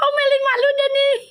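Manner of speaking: high squealing laugh then words still laughing; shrieking laugh. Real, not acted. Laughing at her own slip.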